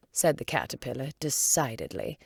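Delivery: ordinary delivery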